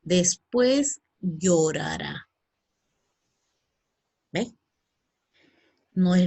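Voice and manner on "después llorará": "Después llorará" said very slowly; slow model answer. Shown as a demonstration. Teacher to pupils, modelling the future very slowly. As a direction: drag out "llorará" syllable by syllable. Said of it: "¿cómo se dirá?".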